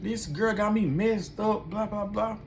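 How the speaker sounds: put-on high, slow whiny drunk complaint; drunk sister's high voice. Drunk sister griping about the girl, high slow whine. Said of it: "And she like".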